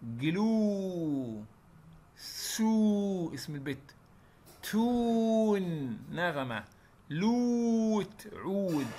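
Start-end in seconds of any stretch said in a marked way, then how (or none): 0.0-1.4 "جلو" with a long drawn-out oo. Shown as a demonstration. Teacher drawing out the long u in "جلو". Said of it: "طويلة شوية". 4.6-6.0 "تون" with a long drawn-out oo. Shown as a demonstration. Teacher drawing out the long u in "تون". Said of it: "طويلة شوية". 7.1-8.3 "لوت" with a long drawn-out oo. Shown as a demonstration. Teacher drawing out the long u in "لوت". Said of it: "طويلة شوية".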